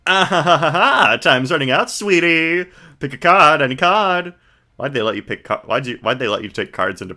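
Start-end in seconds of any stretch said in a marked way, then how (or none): 0.1-4.4 flamboyant magician. Acted. Max greeting the visitors, lightly voiced flamboyant showman. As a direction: ham up a vain showman.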